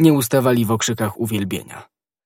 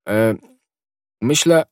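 hesitant, embarrassed start; mildly flustered, halting; blushing. A narrator's reading. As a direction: stall on a short filler before answering, embarrassed. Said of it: "czerwieniąc się".

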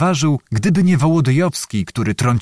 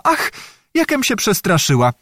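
put-on higher-pitched, girlish little fright; startled girl; 7 semitones higher than usual. A narrator's reading. Anusia bumps into the officer and exclaims, startled. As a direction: exclaim as if taken aback. Feigns a fright after bumping him.

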